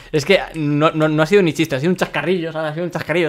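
ordinary delivery

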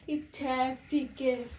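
ordinary talk, nothing marked

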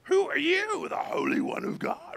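demon voice, swooping from high down to a guttural croak; hammed-up creepy, throaty spirit voice. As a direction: croak it like a scary possessed man. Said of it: "using that creepy, scary, guttural voice".

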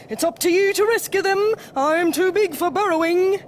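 high, forceful plea; urgent Bear. A narrator's reading. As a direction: press the rescuer to hurry. Bear urgently sends Mole to help.

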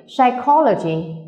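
slow psychology with CHO, said ko, stressed. Shown as a demonstration. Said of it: "nhấn vào core".

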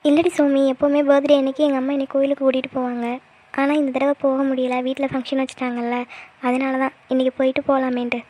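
normal talking voice

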